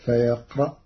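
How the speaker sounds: ordinary talk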